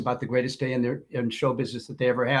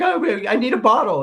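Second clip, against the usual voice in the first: higher, louder nervous request; nervous waitress; 8 semitones higher, 5 dB louder than usual. Retold or imitated. Waitress asking her manager for a bottle, higher and nervous. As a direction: ask the manager anxiously. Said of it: "she's like".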